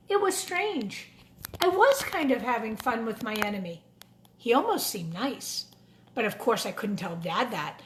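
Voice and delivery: put-on lively storybook narration for kids; a children's book read aloud. A narrator's reading. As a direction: read it like story time in class. Reads picture book to children.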